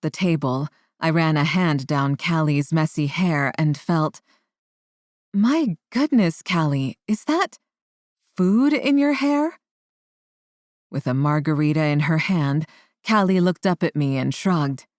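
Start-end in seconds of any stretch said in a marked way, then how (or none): 5.3-9.6 surprised. A narrator's reading. Mother notices food in hair.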